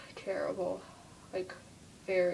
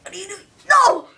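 high, loud panicked pleading up to a shout; alarmed protest; 11 semitones higher, 14 dB louder, faster than usual. Pretend. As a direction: plead in alarm, then yell out. Character being thrown in play.

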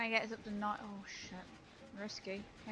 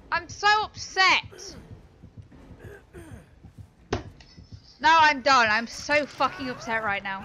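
loud, high, slow enraged rant; angry rant; 5 semitones higher, 13 dB louder than usual. Real, not acted. Streamer ranting to chat after losing, loud furious rant. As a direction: shout it out, still fuming.

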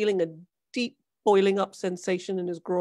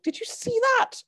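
high shaken question; frightened. A narrator's reading. Son asking his father in shock, high frightened voice. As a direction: ask it stunned and scared. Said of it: "stammered Hank".

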